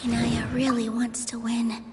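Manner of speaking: a soft unvoiced whisper to herself; whispering, barely voiced; whispered thought. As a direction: breathe it as a private worry. Private thoughts before the debate.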